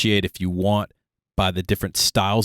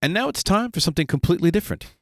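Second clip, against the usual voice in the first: quick, higher announcer voice; Monty Python announcer; 7 semitones higher, faster than usual. Retold or imitated. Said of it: "Monty Python reference".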